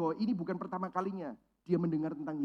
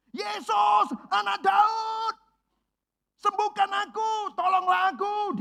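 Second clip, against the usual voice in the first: much higher, louder, slow straining cry; desperate shout; 12 semitones higher, 7 dB louder, slower than usual. Acted. Blind beggar shouting at Jesus, loud, slow and desperate. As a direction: shout for help with all your strength. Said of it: "kedengeran orang teriak-teriak".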